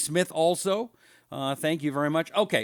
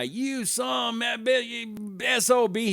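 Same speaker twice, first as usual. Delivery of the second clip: slow, higher, shouted insult; swearing caller; 8 semitones higher, much slower than usual. Retold or imitated. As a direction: bark it out like an angry caller. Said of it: "he starts swearing".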